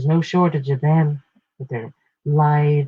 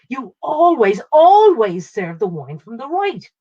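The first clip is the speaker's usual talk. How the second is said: "always" said loudly both times, the second "always" louder still; raised voice, hammering "always" twice over; 8 semitones higher, 6 dB louder than usual. Acted. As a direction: lean hard on each "always", harder the second time. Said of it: "I might have said the "always" a bit loudly, especially the second time".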